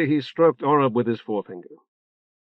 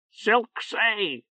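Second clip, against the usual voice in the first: squawking bird voice; 9 semitones higher, 4 dB quieter than usual. A narrator's reading. Talking bird Orb squawks a message.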